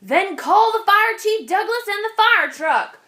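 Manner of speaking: high, loud desperate yell; shout. A narrator's reading. Farmer calling for fire chief Douglas, desperate shout. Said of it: "the farmer shouted".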